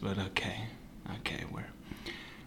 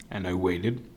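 fading repeat; 5 semitones lower, 6 dB quieter than usual. A narrator's reading. Telling listeners how long he waited, quiet and resigned. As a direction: let it trail off as time drags. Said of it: "repeated fading".

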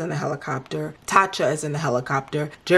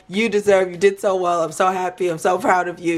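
normal talking voice

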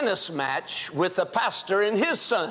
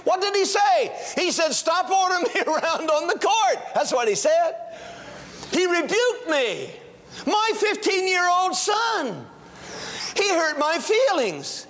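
raised, higher pulpit voice; fervent sermon push; preaching; 9 semitones higher than usual. Acted. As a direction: urge the congregation to act. Preacher's exhortation.

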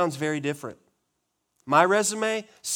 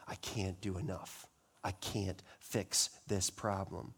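tired despair; 6 semitones lower, 10 dB quieter than usual. Acted. Voicing the worn-out religious.

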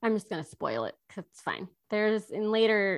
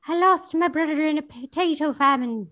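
mock Irish accent; 7 semitones higher, 5 dB louder than usual. Mocking Irish character backstory.

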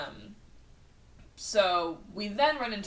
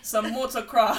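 loud, botched Cockney turning Australian; wandering put-on accent; accent gone Australian; 2 semitones higher, 7 dB louder than usual. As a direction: let the fake British accent slip into Australian. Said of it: "You went Australian and then it went somewhere else".

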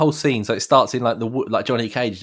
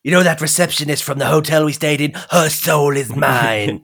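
gloating villain voice; 5 semitones higher, 4 dB louder than usual. Retold or imitated. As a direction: stretch each word like a gloating villain. Mocking Shang Tsung soul obsession.

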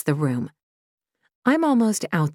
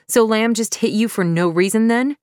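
sharp, raised sarcastic challenge; 6 semitones higher, 5 dB louder than usual. Acted. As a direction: throw the question back in scorn.

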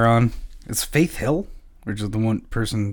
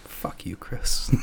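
playful whispered insult; 6 dB quieter, much slower than usual. Pretend. Mock insult to co-host.

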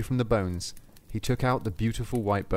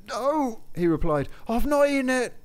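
stubborn denial; 12 semitones higher than usual. Acted. Soldier to Saint Peter, high defiant denial. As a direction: refuse to confess, desperate.

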